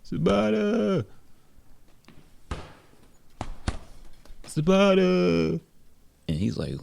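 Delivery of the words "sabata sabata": high, drawn-out call; calling out a name. Acted. Ruben calls Sabata across the gym, high drawn-out call. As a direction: sing the name out high, stretched long. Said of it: "he heard somebody call his name".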